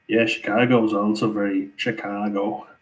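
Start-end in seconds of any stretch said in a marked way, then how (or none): 1.8-2.7 Chicago said with a drawn-out local 'ah'; Chicago accent. Shown as a demonstration. Irishman trying out how locals say Chicago, Chicago accent. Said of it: "that's a good accent as well".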